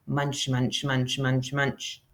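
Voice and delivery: soft, low chewing munches; quiet munching noises while eating. Acted. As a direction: chomp away at the fish. Bear eating salmon.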